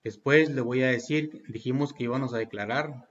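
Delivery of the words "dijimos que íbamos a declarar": usual speaking voice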